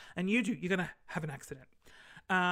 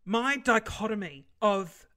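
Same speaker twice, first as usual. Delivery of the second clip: pompous; 3 semitones higher, 8 dB louder than usual. Pretend. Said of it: "let's pretend".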